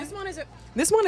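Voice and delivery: muffled, high and fast into a cup; talking into a cup. Real, not acted. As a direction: speak right into the cup. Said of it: "I'm talking into the cup".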